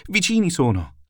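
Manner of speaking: high, urgent warning cry; alarmed shout. Acted. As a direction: shout that the enemy is close. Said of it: "ei tosto gridò".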